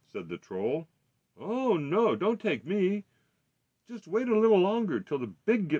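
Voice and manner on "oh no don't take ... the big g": high, loud pleading; pleading goat. A narrator's reading. Second goat begging the troll to wait, high plea. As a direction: beg urgently as the middle goat.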